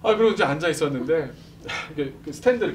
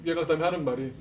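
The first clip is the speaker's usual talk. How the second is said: usual speaking voice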